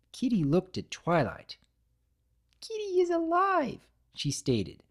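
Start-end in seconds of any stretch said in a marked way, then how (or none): 2.6-3.9 very high, small creature voice; tiny cookie creature. Acted. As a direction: speak like a tiny living cookie. The living cookie speaks.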